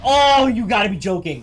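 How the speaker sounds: shrieking in disbelief, high and loud; shocked laughter. Real, not acted. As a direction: cry out in stunned disbelief. Disbelief at game event.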